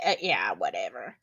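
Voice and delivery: breathy dismissive whisper under the breath; whispered whatever. Real, not acted. Streamer to chat, dismissive whisper. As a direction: brush it off in a whisper.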